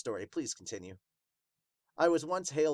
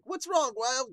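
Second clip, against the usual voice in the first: high-pitched, worried little voice; high character voice; 14 semitones higher, 6 dB louder, slower than usual. Acted. A character checks on Hawk in a high voice. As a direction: ask what's wrong in a high voice. The reread of the same line in the character voice.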